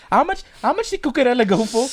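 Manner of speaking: usual speaking voice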